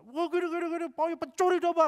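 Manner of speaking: beaucoup plus aigu, agité; répéter l'insulte en s'énervant; accusation répétée. Retold or imitated. Orang marah-marah mengulang.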